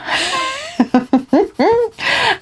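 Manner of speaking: high bursting giggle; laughing. Real, not acted. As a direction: break into a squeaky giggle. Laughs at own thought.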